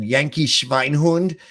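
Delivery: slow, hissed-out German insult; a passing German woman scolds the clumsy American, mock upset. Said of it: "she was upset and turned".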